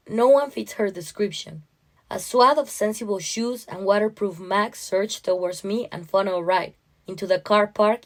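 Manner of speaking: usual speaking voice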